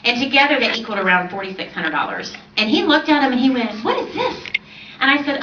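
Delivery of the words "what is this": much higher, astonished question; surprised. Retold or imitated. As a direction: squeak it out in disbelief at the checks. Husband sees the checks.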